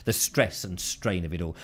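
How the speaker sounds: normal talking voice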